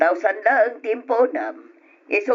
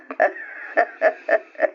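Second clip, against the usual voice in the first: voiceless scheming snicker; breathy sly laugh. Acted. Lender scheming with husband.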